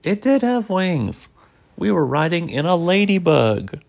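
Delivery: lively read-aloud with swooping pitch; animated picture-book narration; story reading to children. A narrator's reading. Reading a bug adventure aloud to a child, animated storytelling.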